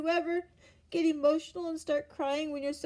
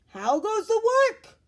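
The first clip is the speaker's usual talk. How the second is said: high, louder call of the lazy farmer; 9 semitones higher, 8 dB louder than usual. A narrator's reading. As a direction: shout it up from the bed. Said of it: "called the farmer".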